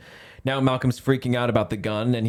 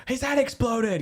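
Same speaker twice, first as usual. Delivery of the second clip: put-on high girl voice; 13 semitones higher than usual. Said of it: "the girl is like".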